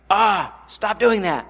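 mock self-flogging voice; harsh inner critic. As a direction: scold yourself like a nagging critic. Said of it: "beat ourselves".

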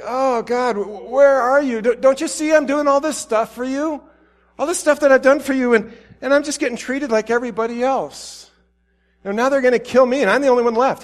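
high, pleading, despairing lament; despairing Elijah. Retold or imitated. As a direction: whine it out like a prophet at the end of his rope. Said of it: "He's completely in despair".

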